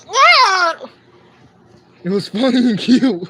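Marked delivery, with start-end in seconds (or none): 0.1-0.8 short high mock yowl; imitated cat yowl. Streamer showing viewers the cat's noise, imitated yowl. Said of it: "he actually made noises. He was like".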